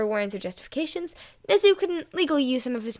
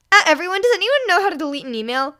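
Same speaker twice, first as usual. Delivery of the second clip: panicked plea; 7 semitones higher, 9 dB louder than usual. A narrator's reading. Panicked group chat.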